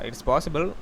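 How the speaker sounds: usual speaking voice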